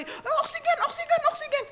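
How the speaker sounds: high-pitched urgent mock cry; squeaky-high, hammed-up alarm; panicked nurse. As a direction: imitate a frantic nurse calling for supplies. Acted nurse rushing for oxygen.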